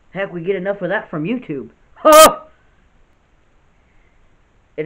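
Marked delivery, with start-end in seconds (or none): 2.0-2.4 a short high burst of laughter; sudden loud laugh. Real, not acted. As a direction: let one sharp laugh burst out. Laughs at his YouTube joke.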